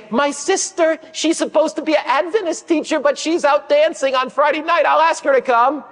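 put-on high, earnest little girl voice. Little girl telling the teacher about her sister, high childlike voice.

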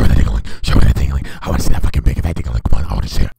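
low menacing growl of a demand; deep creepy voice. Pretend. Creepy prank voice on video chat.

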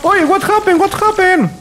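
shocked, loud, high call; startled shout. Real, not acted. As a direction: yelp it out in sudden alarm.

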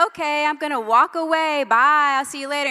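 loud, breezy fake goodbye to a hiding child; mock leaving. Mother to hidden daughter, teasing loud goodbye. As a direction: call out loudly like you're really walking off. Mom pretending to leave daughter.

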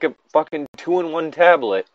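ordinary talk, nothing marked

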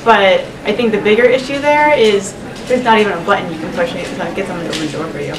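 normal talking voice

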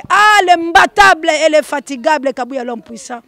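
high, loud acclaim; hype-man shout. Real, not acted. Host hailing the powerful man, hype-man shout. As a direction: belt out the nicknames in praise.

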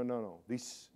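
ordinary talk

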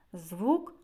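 ordinary talk

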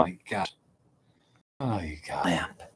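soft whispered aside. Real, not acted. Hushing for a sleeping friend, whispered. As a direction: whisper it so nobody wakes. Hushed aside at 3am stream.

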